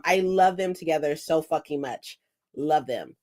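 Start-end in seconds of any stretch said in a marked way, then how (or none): none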